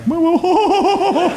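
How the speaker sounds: high, laughing alarm; overwhelmed young guy; stunned whoa. Retold or imitated. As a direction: freak out, half laughing. Stunned reaction.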